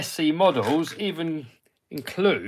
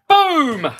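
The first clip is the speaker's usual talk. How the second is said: excited; 12 semitones higher, 7 dB louder than usual. Real, not acted. Triumphant exclamation after finishing.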